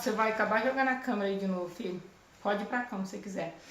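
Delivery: low, quick aside to a son; quiet aside. Real, not acted. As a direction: drop the voice for a hurried word off-mic. Aside to son during the recording.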